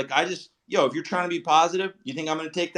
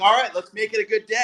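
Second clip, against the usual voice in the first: higher-pitched, upbeat morning resolve; self pep talk; 6 semitones higher than usual. Retold or imitated. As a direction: act out psyching yourself up for the day. Acting out his morning self-talk.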